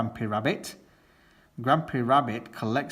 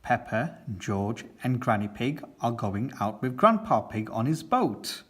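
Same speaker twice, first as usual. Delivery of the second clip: usual speaking voice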